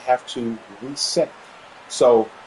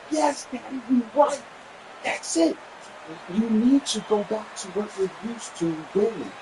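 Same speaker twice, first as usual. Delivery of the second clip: excited high praise; 9 semitones higher than usual. Retold or imitated. Cheering on her father.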